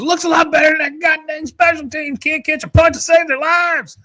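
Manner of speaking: very high, strained mock coach scream; yelling coach. Imitating a coach yelling back about his special teams, high-pitched scream. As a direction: yell it back across the field in exasperation. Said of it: "yells back and goes".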